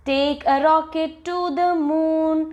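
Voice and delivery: drawn-out rhyme on a held pitch; poem recitation. Shown as a demonstration. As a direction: recite it for children, stretching the words. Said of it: "so let us recite this".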